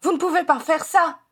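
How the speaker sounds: high-pitched, alarmed protest; desperate exclamation; horrified outcry. A narrator's reading. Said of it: "s'écria Edmund, pâle comme la mort".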